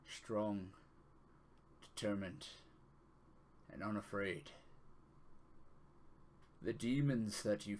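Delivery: grave, measured divine speaker; solemn deity voice. Acted. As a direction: speak as a solemn god to his champion. GM voicing a deity's message.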